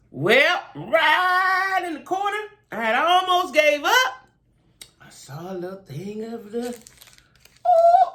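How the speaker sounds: high, slow, thrilled discovery; rising to a delighted squeal; excited. Real, not acted.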